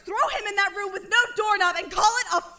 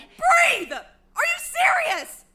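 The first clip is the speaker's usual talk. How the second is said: incredulous angry; 8 semitones higher, much slower than usual. Acted. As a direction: throw the word back at her in disbelief. Told to breathe while furious.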